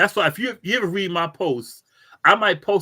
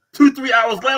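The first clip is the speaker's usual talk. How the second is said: ordinary talk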